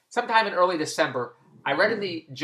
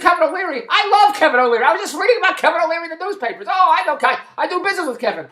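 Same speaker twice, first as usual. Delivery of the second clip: much higher and louder, gushing; retelling his own starstruck delight, higher and louder; thrilled fan; 12 semitones higher, 7 dB louder than usual. Retold or imitated.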